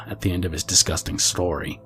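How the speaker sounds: usual speaking voice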